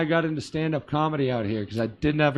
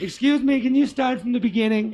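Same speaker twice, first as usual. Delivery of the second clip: high request voice; 10 semitones higher, 4 dB louder than usual. Retold or imitated. Imitating a listener's request.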